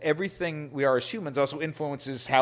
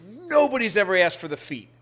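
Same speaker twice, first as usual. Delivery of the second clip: retold indignation; 7 semitones higher, 4 dB louder than usual. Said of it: "she was angry at me".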